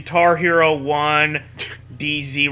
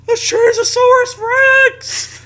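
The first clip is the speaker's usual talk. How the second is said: excited shout; 6 dB louder than usual. Acted. Shouted punchline.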